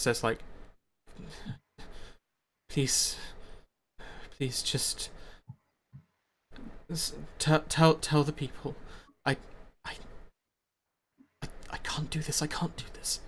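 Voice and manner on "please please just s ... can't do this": faltering, stammered near-whisper; panicked pleading. Acted. Leader begging Eminora to tell the people, panicked whisper. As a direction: struggle to get words out, then whisper helplessly. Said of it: "with this look of panic".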